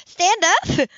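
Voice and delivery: high, loud startled question; alarmed disbelief. A narrator's reading. Woman told to stand on the boat, in startled disbelief. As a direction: recoil at the very idea.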